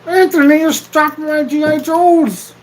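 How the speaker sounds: high, drawn-out mock holler; mock yelling. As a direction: holler it high, stretching the words.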